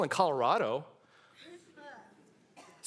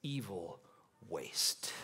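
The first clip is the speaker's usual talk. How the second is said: low, slow, spitting each insult; contemptuous low snarl; 9 semitones lower, 8 dB quieter, much slower than usual. Retold or imitated. Jesus facing the enemy, low contemptuous scorn. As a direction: stare the enemy down and spit it out. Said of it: "He says".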